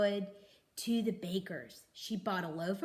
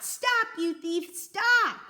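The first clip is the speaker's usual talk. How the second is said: loud, high shout after a fleeing thief; calling out; 11 semitones higher, 7 dB louder, slower than usual. A narrator's reading. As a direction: yell it out, chasing him. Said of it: "calling out".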